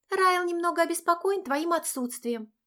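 put-on high girlish friend voice; chirpy young friend; cartoon princess. A narrator's reading. (высокий девичий голосок)

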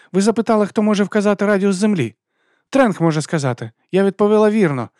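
high, loud schoolgirl argument; heated protest. A narrator's reading. Abby arguing with the teacher over her answer, heated. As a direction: argue your case in a high, heated voice. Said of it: "Еббі розпашіла".

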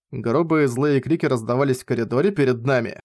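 plain reading voice

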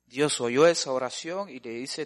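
ordinary talk, nothing marked

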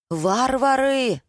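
high, drawn-out cry of indignation; stretched, outraged exclamation; foot-stamping outrage. A narrator's reading. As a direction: fling the insult out, pitched high. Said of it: "затопала ногами Надя".

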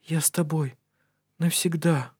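soft and high, tender; tender whisper. A narrator's reading. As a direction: breathe it gently to him. Tender vow at bedside.

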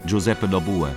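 ordinary talk, nothing marked

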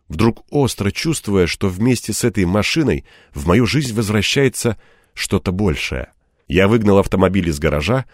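ordinary delivery

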